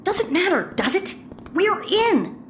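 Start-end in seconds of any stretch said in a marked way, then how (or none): 1.5-2.4 high, quick triumphant "we're in"; excited announcement. Acted. Plumber tells partner they made it inside, excited. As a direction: announce getting in with a high lift. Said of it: "Let me say that again".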